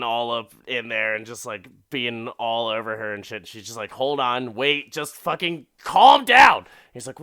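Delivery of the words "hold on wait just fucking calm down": retold shout building to a loud peak; exasperated outburst. Woman telling an overeager man to calm down, exasperated shout. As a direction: yell it like you've had enough.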